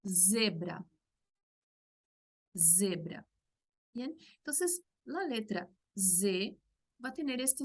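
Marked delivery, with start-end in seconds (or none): 0.0-3.2 Zebra with a voiced buzzing z; Portuguese z. Shown as a demonstration. Teacher modelling a Portuguese word, voiced z. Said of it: "un ejemplo de una palabra con Z".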